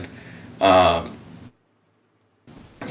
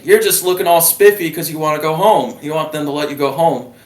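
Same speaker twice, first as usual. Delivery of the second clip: teasing general; 7 semitones higher than usual. Retold or imitated. Voicing the Australian general.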